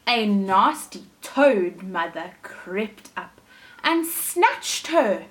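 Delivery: slow, suspenseful storytelling; story reader slowing for the scary part; slow dramatic narration. A narrator's reading. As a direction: draw it out to build suspense.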